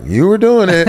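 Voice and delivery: high-pitched imitation of wife; teasing insistence. Wife sides with son against husband, high teasing insistence. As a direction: back up the accusation, pitched high.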